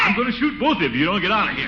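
high exasperated bluster; exasperated scolding. Acted. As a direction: scold the pests. Scolding noisy children.

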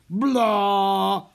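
long, loud, high "blah" like a trombone; mock trombone blare. A narrator's reading. Trombone mocking the magicians' fumbles, blaring. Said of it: "played the trombone".

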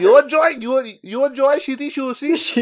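high, loud mock Chinese accent; South Park restaurant owner. Cartoon owner offering sushi, mock Chinese accent. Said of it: "what was his shop?".

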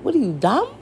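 ordinary talk

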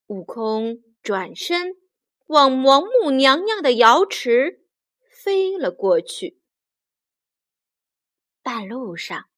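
plain reading voice

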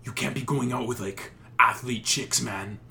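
loud drawling Toronto bro; Toronto slang voice. Retold or imitated. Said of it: "this one guy's like".

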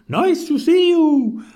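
high, loud hoot; swooping high hooting call; hooted greeting. A narrator's reading. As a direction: hoot a delighted hello. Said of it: "and they hooted".

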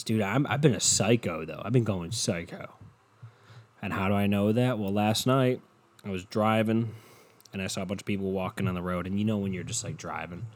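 usual speaking voice

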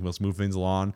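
serious mellow. Retold or imitated. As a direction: play it down, very serious. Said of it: "he was very serious".

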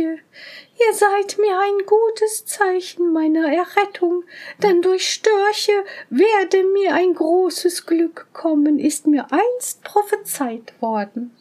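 high, slow owl woman voice; a joyful old owl calling out. Acted. Owl speaks, much higher.

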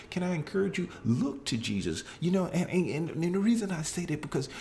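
high, fast, impassioned preaching; preaching at full force. Acted. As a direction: raise it up and preach. Peak of the sermon.

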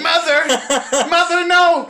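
hammed-up high, loud shouting at Mother; Psycho-style screaming. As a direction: shriek in mock horror at your mother. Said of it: "in Psycho you do hear the mother scream".

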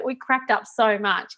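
ordinary delivery

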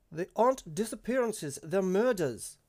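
loud, high protest from a villager; outraged cry. A narrator's reading. Bereaved mother to the clan leader before the crowd, outraged cry. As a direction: cry it out to correct her.

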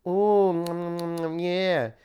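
exaggerated purring approval; mock moaning. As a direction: moan appreciatively in jest. Mock moaning over the picture.

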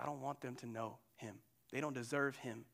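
low, hushed grumble as Jonah; quiet resentment. Acted. Preacher voicing Jonah who resents Nineveh, quiet resentment. Acts out angry Jonah's private refusal.

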